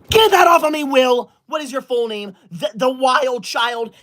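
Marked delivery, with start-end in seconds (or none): 0.1-1.3 high, loud indignant yell; angry demand. Real, not acted. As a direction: shout it at the culprit. Angry at cat-ear filter.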